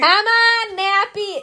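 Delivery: high, loud dog-owner holler; calling the dog. Acted. Showing friend how the name sounds, yelled dog call. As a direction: yell for the dog to come. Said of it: "when you're yelling it at a dog park".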